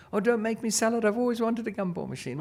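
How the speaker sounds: high-pitched imitation of a pleading kid; pleading child. Daughter begging dad not to sell it, pleading. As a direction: plead like a child keeping a treasure.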